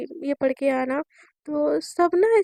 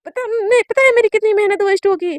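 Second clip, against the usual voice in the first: higher, louder whining rant; girl scolding her mother for not telling her, loud and upset; frustrated outburst; 6 semitones higher, 7 dB louder than usual. Acted. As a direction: vent at mom, all that effort wasted. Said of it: "relax, क्या हो गया?".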